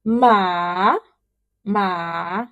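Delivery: MA long, dipping then rising; third tone. Shown as a demonstration. Teacher reviewing four tones, dipping "ma". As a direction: draw "ma" down, then up. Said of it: "let's have the four tones together".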